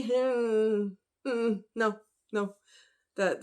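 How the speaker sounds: usual speaking voice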